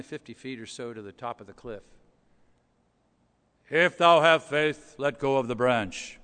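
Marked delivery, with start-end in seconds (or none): 3.7-6.2 booming voice of God. Retold or imitated. God repeating his command from heaven, booming. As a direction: let it ring down from above. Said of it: "this voice booms from heaven".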